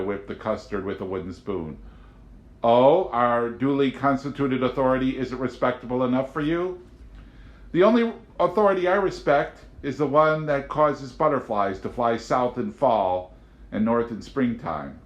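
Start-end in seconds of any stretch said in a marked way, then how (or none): 2.6-6.8 louder mocking question; sarcastic agent. A narrator's reading. Agent mocking Amanda, louder and sarcastic. 7.7-15.0 higher, calm and assured; Amanda serene. A narrator's reading. Amanda calmly answering the agent.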